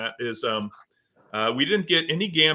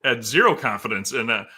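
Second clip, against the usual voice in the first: ordinary delivery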